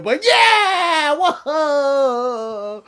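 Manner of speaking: imitating the arena cheering Becky's win; crowd cheer.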